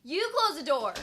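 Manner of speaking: loud, high, playful yell; shouting. Real, not acted. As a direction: shout it back at her. Shouts back at other person.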